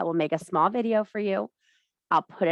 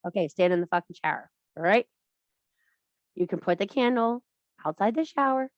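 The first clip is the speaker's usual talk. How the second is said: normal talking voice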